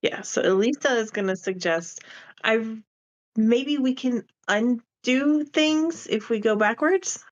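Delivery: ordinary talk, nothing marked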